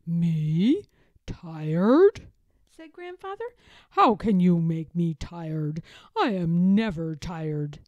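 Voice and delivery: a jolly grandpa protesting loudly; indignant grandfather. A narrator's reading. Grandfather indignant at being tired.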